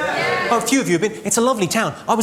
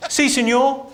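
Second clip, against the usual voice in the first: put-on Spanish accent; 6 semitones higher, slower than usual. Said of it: "'Cause it's Spanish".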